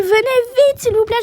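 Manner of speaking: pressant, plus aigu et plus fort; appel urgent. Acted. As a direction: presser la police de venir vite. (femme suppliant la police d'accourir)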